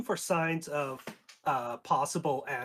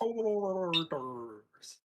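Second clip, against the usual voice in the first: gargled words through water; while gargling; 3 semitones higher than usual. Acted. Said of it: "you gotta speak while gargling water".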